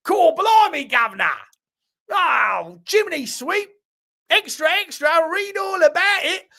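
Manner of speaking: high, hammed-up mock Cockney street cry; American's bad English accent. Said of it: "an American doing an English accent".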